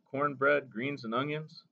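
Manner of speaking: ordinary delivery, nothing marked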